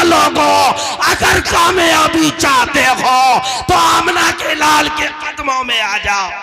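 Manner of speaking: high, drawn-out shouted appeal; impassioned cry. Acted. Preacher calling people to success at the prophet's feet, impassioned cry. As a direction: cry it out slowly, falling away at the end. Shouted climax, later repeated softly.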